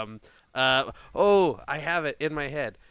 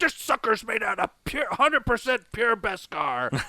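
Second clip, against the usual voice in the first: high, drawn-out actor impression; Christopher Lloyd; 7 semitones higher than usual. Podcaster imagines the actor in the show, high impression. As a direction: pitch it up and stretch the key words. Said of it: "He's like".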